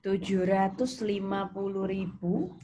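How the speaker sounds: ordinary talk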